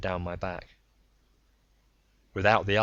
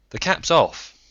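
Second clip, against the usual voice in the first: reporting over speaker; 9 semitones higher, 8 dB louder, slower than usual. A narrator's reading. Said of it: "Monig reported".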